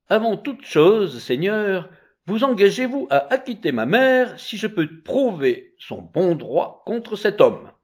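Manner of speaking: high, deliberate formal request; earnest child pleader. A narrator's reading. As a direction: address the judge insistently.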